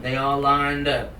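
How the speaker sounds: usual speaking voice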